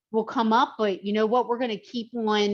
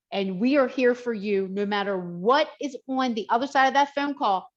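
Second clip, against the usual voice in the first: ordinary talk, nothing marked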